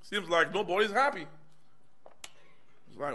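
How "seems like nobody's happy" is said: ordinary delivery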